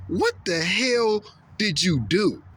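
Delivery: slow, high furious demand; Moses yelling. Retold or imitated. Moses confronting his brother Aaron, slow high angry yell. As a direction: drag out each word like an outraged Moses. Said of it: "he yelled at his brother".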